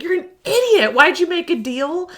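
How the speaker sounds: high-pitched exasperated scolding; mock yelling. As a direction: imitate a friend telling someone off. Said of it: "He's, like, yelling at her".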